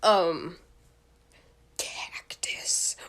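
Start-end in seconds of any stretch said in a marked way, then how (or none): 1.8-2.9 hushed voiceless whisper; dramatic whisper of a random word. Real, not acted. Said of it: "why I said that so dramatically".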